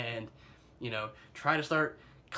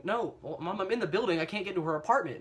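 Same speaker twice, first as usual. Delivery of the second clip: flustered reply; 6 semitones higher, 3 dB louder than usual. Retold or imitated. Answering mom, exasperated.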